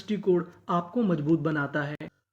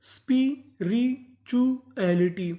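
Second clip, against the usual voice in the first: very slow, syllable by syllable, spirituality; teacher stretching spirituality syllable by syllable; slow spelled-out reading; 4 semitones higher, much slower than usual. Shown as a demonstration. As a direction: draw out spirituality slowly for the class. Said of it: "तो पूरी meaning क्या बनी?".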